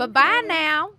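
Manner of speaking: high, loud chirpy bye-bye; sing-song bird goodbye. Acted. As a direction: chirp it like a departing songbird. Cardinal NPC farewell.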